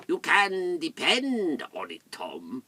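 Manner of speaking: slow, drawn-out voice of ice; icy character voice, starting high and dropping. Acted. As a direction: speak as ice itself, stretching the words. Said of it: "said in a very icy voice".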